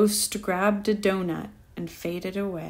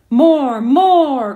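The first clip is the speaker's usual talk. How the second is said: loud, higher bellowing demand; mighty bellow; 6 semitones higher, 10 dB louder than usual. A narrator's reading. Ghost bellowing at Dan for more, loud and rising. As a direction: bellow it, each word bigger. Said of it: "with a mighty bellow".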